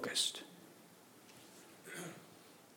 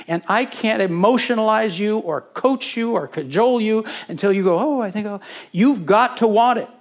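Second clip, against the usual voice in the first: higher, louder, driving sermon cadence; full-force preaching; 6 semitones higher, 5 dB louder than usual. Acted. Pastor urging his congregation, full-force preaching. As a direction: preach it hard to the back row.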